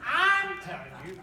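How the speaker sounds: hammed-up father cracking down with a laugh; dad scolding.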